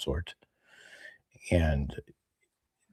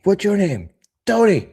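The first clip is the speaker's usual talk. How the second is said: skit voice; 6 dB louder than usual. Acted. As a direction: shout it high like a yokel. Acted skit, high voice.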